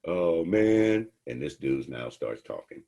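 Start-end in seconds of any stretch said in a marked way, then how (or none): 0.0-1.1 loud, higher oh man of dismay; a buddy realizing he was sold out; dismayed groan. Acted. Buddy sees friend with food.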